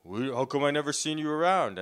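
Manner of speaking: imitating a bullying stranger; mock confrontation. Army guy confronting a young man in his neighborhood.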